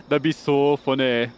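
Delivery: slow, loud mock Scottish; bad Scottish accent. Recalling the one phrase they know, hammed-up Scottish accent. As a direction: exaggerate a Scottish accent, drawn out. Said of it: "one phrase in a Scottish accent".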